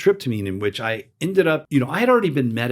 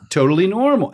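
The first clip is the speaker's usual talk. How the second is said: higher, louder, marvelling repeat; amazed echo; 7 semitones higher, 5 dB louder than usual. Real, not acted. Echoing his friend's casual words, amazed and louder. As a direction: echo it back in wonder.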